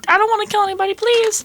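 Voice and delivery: high, whiny limp begging; mock whiny plea. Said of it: "than just being like".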